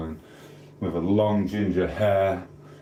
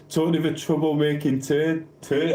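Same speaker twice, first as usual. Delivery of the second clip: higher, giggly relish of the nickname; chuckling, pitched-up delight; amused repeat; 7 semitones higher than usual. Real, not acted. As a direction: savour the silly name with a laugh. Laughing repeat of viewer's nickname.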